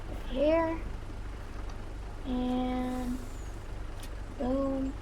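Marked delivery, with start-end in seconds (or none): none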